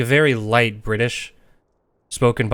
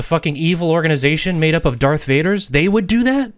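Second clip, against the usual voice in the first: mock disbelief; 6 semitones higher, 3 dB louder than usual. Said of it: "sarcastic mock disbelief".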